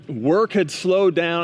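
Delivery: usual speaking voice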